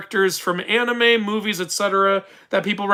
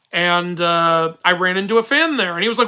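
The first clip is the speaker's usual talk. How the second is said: ordinary talk, nothing marked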